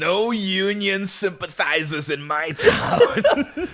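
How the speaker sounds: high, slow, laughing proclaim; lightly voiced gruff father; laughing mock stern father. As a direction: ham up the stern townsman while laughing.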